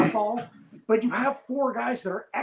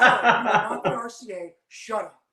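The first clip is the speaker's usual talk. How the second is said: laughing put-down; 3 semitones higher, 7 dB louder than usual. Retold or imitated. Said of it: "he goes".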